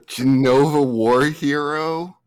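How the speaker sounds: slow, drawn-out questioning repeat; baffled query, dragged out; incredulous echo. Real, not acted. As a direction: stretch it out in disbelief, rising. Incredulous echo of absurd name.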